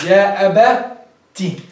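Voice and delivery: يا أبتي very slow, broken before the final "tii"; drawn-out teaching pronunciation of يا أبتي; slow breakdown. Shown as a demonstration. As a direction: split يا أبتي into slow parts, isolating the last syllable.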